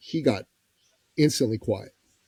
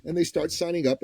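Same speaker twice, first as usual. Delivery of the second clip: ordinary talk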